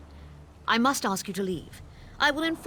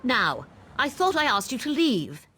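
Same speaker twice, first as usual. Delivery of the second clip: rising fury, louder each line; angry; 3 semitones higher, 5 dB louder than usual. Acted. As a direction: she builds from irritation to outrage. Wife confronting intruder.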